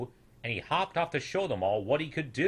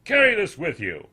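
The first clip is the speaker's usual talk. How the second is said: put-on louder, knowing old voice; condescending elder; 5 semitones higher, 9 dB louder than usual. A narrator's reading. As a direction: talk down to the youngster. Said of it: "the old snoodle said".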